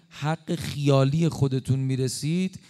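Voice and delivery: ordinary delivery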